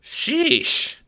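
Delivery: high squeaky Italian-American chipmunk; fast-talking little mobster, squeaky; Ace, chipmunk wiseguy. Acted. Little guard talks to the Seer, squeaky wiseguy.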